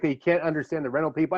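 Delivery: usual speaking voice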